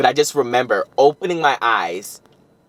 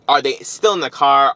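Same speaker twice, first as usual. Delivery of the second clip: ordinary talk, nothing marked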